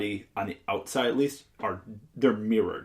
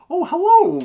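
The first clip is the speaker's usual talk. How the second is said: very high, loud greeting; sky-high over-the-top hello; 10 dB louder than usual. Shown as a demonstration. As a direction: put on a preppy squeal. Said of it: "But just be like".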